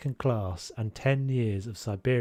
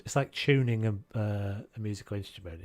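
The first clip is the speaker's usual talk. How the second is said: ordinary talk, nothing marked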